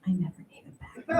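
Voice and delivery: quick secret whisper; whispered confession. Acted. Confessing to the audience, fast whisper.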